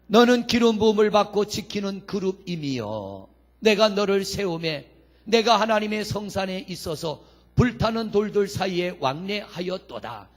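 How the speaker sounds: loud, solemn pulpit recitation; firm, formal Bible reading; scripture reading. Acted. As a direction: proclaim the verse to the congregation. Reads scripture aloud to congregation.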